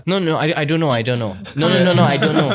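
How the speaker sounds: put-on playful flustered little-kid denial; mock panic. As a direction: deny it hurriedly like a scared kid. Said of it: "she, she panicked".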